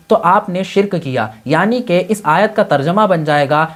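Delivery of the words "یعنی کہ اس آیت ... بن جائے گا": ordinary talk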